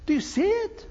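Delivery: normal talking voice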